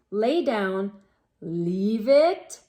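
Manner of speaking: ordinary delivery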